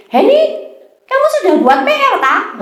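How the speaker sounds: high, fast nagging mum; mother's voice. Retold or imitated. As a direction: rattle it off like a mum checking homework. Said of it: "Misalnya nih".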